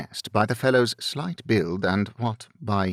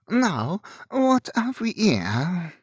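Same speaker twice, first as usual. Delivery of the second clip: put-on higher, drawn-out French-accented robber; 9 semitones higher, slower than usual. A narrator's reading. Second French-accented robber looks into the carriage, higher and slow. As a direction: ask it slowly, lifted, in a French accent. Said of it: "Enage asked".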